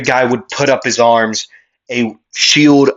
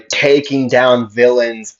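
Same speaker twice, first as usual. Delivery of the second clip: plain reading voice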